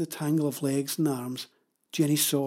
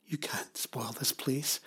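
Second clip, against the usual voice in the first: slow, whispered plea; soft; 8 dB quieter, slower than usual. A narrator's reading. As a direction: whisper it gently. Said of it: "The woman's face softened".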